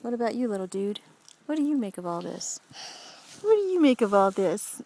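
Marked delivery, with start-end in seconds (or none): 3.4-4.8 the same question again, louder, to the pet; louder playful call. Real, not acted. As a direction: call it out brightly to the little dude. Mom asks the pet twice.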